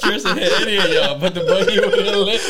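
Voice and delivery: cracking up, pitch lifted; barely getting the line out for laughing; laughing quote. Retold or imitated. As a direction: repeat the punchline through laughter. Cracking up repeating friend's punchline.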